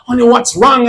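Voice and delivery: ordinary talk, nothing marked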